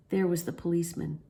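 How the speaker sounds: ordinary delivery, nothing marked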